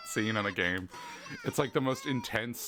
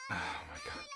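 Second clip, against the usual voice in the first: high, soft disbelief; exasperated; 9 dB quieter, much faster than usual. Real, not acted. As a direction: breathe it out, worn out. Stuck loading again.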